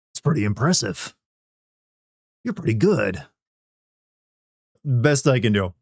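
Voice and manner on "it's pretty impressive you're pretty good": terse soldier praise; Solid Snake. Pretend. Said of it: "congratulate me and Solid Snake's voice".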